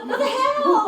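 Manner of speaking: high, loud, incredulous squawk; laughing, hammed-up indignant yelp; mock outrage. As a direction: cry it out in comic disbelief. Retelling own outrage at hang-up.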